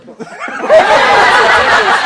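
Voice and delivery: high, loud cackle; shrieking laugh. Real, not acted. Loud laugh at own joke.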